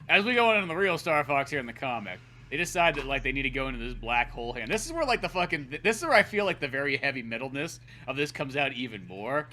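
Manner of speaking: ordinary talk, nothing marked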